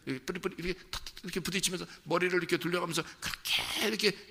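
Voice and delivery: normal talking voice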